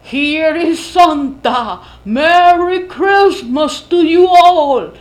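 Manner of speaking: booming cavernous voice, slow and loud; deep echoing underworld god. Acted. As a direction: boom it out slowly like a god from the underworld. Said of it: "diciendo en voz cavernosa". (voz cavernosa y retumbante, lenta)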